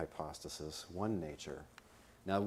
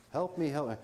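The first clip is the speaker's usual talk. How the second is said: high-pitched mock plea for help; possessed victim; 9 semitones higher than usual. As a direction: switch back to the pleading victim, pitched high. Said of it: "when the voices change".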